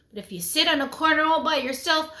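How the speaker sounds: usual speaking voice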